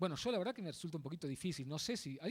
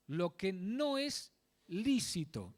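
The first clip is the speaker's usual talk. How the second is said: "no es lícito" very slow, "lícito" set apart; stress on lícito; much slower than usual. Real, not acted. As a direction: spell out the charge, landing deliberately on "lícito". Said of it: "recalco esta palabra". (recalcar "lícito" muy despacio, palabra por palabra)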